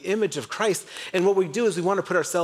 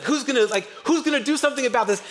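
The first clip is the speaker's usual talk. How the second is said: higher, faster, eager mock outrage with a laugh in it; impatient kid bursting to act; passionate young David; 9 semitones higher, faster than usual. Young David demanding someone face the giant, mock eager. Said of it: "He's so passionate".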